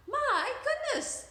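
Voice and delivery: high, slow, loud little gasp of surprise; old lady exclaiming. Acted. Old woman seeing Camellia's room, high and amazed. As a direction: marvel at the mess.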